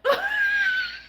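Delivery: very high shrieking laugh, almost a scream. Real, not acted. Viewer shrieks with laughter at the joke. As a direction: let the laugh break into a shriek. Reacting to funny clip.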